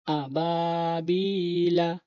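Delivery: আবাবিলা drawn out very slowly; slow model reading; slow demo. Shown as a demonstration. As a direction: stretch every syllable for students. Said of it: "teacher models the joined word".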